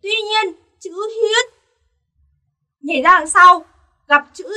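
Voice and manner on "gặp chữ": ordinary talk, nothing marked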